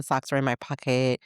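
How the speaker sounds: ordinary talk, nothing marked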